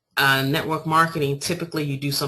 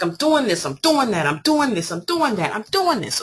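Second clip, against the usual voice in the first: exasperated listing; 7 semitones higher than usual. Retold or imitated. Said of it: "I was like".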